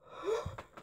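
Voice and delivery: soft unvoiced gasp of fright; a quick frightened intake of breath; real startled gasp; breathy startled gasp. Real, not acted. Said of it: "It just scared me so much".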